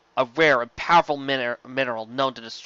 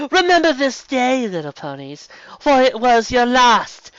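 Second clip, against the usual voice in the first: much higher, loud building to a shout; villain's proclamation; 12 semitones higher, 8 dB louder than usual. A narrator's reading. Nightmare Moon threatens the ponies, loud high villain shout. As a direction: proclaim doom and gloat.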